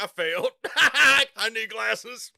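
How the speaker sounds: giggling confession of failing; sheepish laughing reply. Retold or imitated. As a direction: laugh through the words, very slow on the second line. Retelling own answer, laughing.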